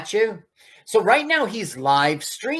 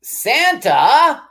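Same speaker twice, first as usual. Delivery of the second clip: surprised; 11 semitones higher, 9 dB louder than usual. Real, not acted. Surprised call at screen.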